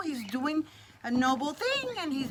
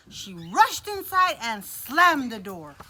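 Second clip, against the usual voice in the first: usual speaking voice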